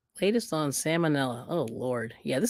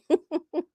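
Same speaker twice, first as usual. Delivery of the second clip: kind gentle laugh; 12 semitones higher than usual. Real, not acted. Laughing at chat.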